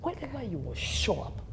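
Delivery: quiet, solemn retold promise; hushed prophecy. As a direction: imitate a preacher blessing someone softly. Retelling the preacher's hushed prophecy.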